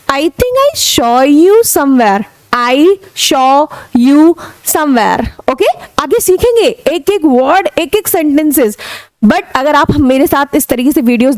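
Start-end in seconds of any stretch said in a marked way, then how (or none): 0.1-5.5 very slow, drawn-out model sentence; slow demo. Shown as a demonstration. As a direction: say it slowly for learners. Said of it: "अगर आपको ये बोलना है".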